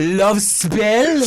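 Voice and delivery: drawn mock-French accent. Naming a scent in a fake French accent. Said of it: "we are talking in French".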